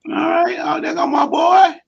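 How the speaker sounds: high, loud joyful hail; host greets his friend loud and high; excited greeting. Real, not acted.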